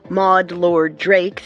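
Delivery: usual speaking voice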